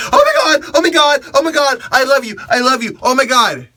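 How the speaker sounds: shrieking way up high and loud; screaming fan. Shown as a demonstration. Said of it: "Don't cause a scene".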